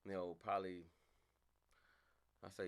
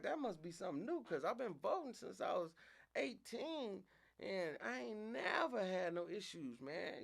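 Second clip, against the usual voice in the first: indignant complaint; 11 semitones higher than usual. Retold or imitated. Complaining to the clerk.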